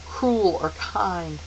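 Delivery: usual speaking voice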